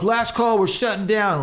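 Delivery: lightly voiced bartender announcing closing; grumpy bartender. Bartender to racing team at closing time, mock last call.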